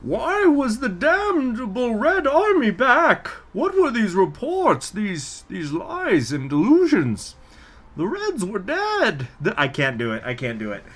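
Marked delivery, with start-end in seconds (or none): 0.0-9.3 high, slow masked villain voice, pitched up like a woman; a woman's Bane through the mask, pitched high and slow; female Bane voice. Acted. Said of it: "try to do a female Bane voice".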